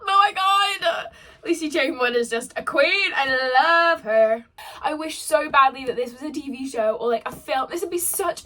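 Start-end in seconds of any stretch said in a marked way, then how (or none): 0.1-1.1 slow, loud, high, half-crying oh my God; swooning cry. Real, not acted. Swooning over romantic book line. 1.4-4.4 gushing adoration. Real, not acted. Gushing about the author.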